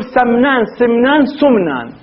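"سمنان، سمنان، سمنان" as samnan, simnan, sumnan; three vowellings. Shown as a demonstration. Teacher reports the dictionary, "سمنان" said samnan, simnan, then sumnan. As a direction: step the first vowel of "سمنان" through a, i, u. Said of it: "راجعت كتاب المحيط".

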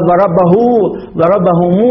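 "ضربه" as long -huu, "ضربهم" as long -humuu; connected-speech endings. Shown as a demonstration. Teacher showing how he says it, "ضربهم" with long -humuu. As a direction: stretch the final u of "ضربه" and "ضربهم". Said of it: "هكذا يقول".

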